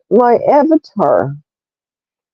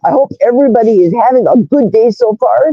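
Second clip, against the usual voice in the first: usual speaking voice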